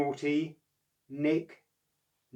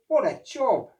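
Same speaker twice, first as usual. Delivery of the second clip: dismayed; 10 semitones higher, 9 dB louder, slower than usual. Acted. Oscar dismayed.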